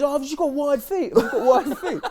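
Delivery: high, defensive excuse; defensive mate. Retold or imitated. Mate insisting to the lads he has wide feet, high and defensive. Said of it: "he goes".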